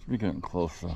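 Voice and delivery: ordinary delivery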